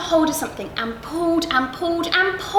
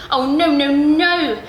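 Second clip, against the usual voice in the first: emphatic refusal; 7 dB louder, slower than usual. Acted. Crocodile declines, vigorous.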